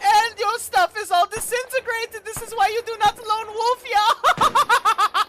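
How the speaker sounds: laughing. Acted. Villain gloats over naked prisoner.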